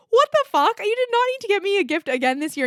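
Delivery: high, loud shocked outburst at the gift; stunned disbelief. Retold or imitated. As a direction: squeal it out in happy disbelief.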